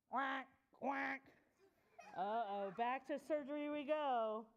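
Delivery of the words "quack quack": short, abrupt duck quacks. Acted. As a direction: quack like a duck in a read-aloud. Puppy quacks at the vet.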